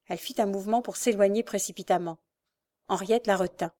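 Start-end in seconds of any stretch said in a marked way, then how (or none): none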